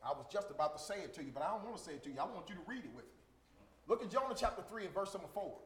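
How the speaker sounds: ordinary talk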